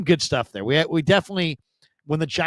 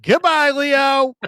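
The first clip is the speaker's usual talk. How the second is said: high, slow drawn-out goodbye; imitating Gary's sign-off; 12 semitones higher, 6 dB louder, much slower than usual. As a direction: stretch the farewell like a showman. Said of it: "he's like".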